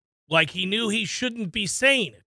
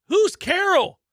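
mock baffled question; 11 semitones higher, 3 dB louder, slower than usual. Acted husband quoting himself.

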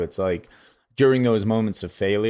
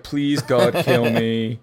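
mock desperate plea; 2 semitones higher, 3 dB louder, much slower than usual. Inner plea while bombing on stage.

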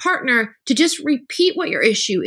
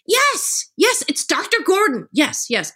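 eager yes; 7 semitones higher, slower than usual. Retold or imitated. Retold eager reply to husband.

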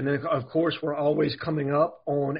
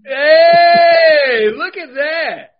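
loud, high, drawn-out hey; excited shout; 11 semitones higher, 13 dB louder, much slower than usual. Real, not acted. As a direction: shout it across the room at the shorts. Cohost shouts at the shorts.

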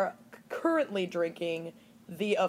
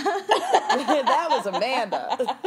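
slow, laughing through it; 6 semitones higher, 5 dB louder, much slower than usual. Real, not acted. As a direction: giggle out the words. Laughing repeat of cohost.

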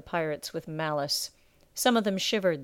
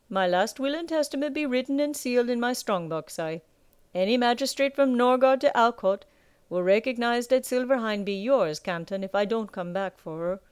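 gruff pirate captain; 5 semitones higher than usual. Acted. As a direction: lord it over the captured pirates. Captain explains his will.

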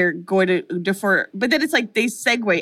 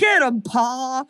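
mock hillbilly line; 3 semitones higher, much slower than usual. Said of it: "laughing inappropriately".

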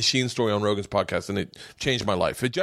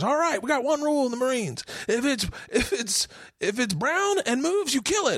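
mock drill instructor, pitched up high; marine instructor. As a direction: bark the rule like a hammed-up marine. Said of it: "And then the guy goes".

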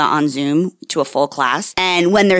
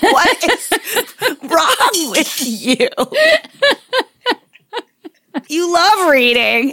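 mock exasperation; 9 semitones higher than usual. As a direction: act stunned at the kid, laughing. Mother's mock exasperation retold.

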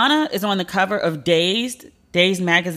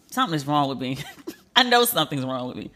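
ordinary delivery

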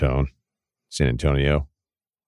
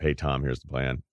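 low, soft coach's mumble; Bill; 3 semitones lower, 5 dB quieter than usual. Acted. Coach floating a contract plan to his player, in a quiet mumble. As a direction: mumble it quietly with hardly any voice. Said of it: "I don't think Bill would say straight up".

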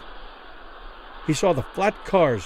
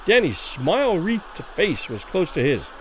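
usual speaking voice

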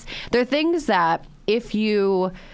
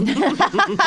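loud high laugh; high burst of laughter; 11 semitones higher, 5 dB louder than usual. Real, not acted. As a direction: crack up laughing. Laughing at own joke.

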